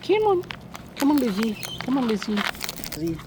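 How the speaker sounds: high sing-song coaxing to a bird; high coaxing call. Real, not acted. To a bird called Lizzie, high coaxing call.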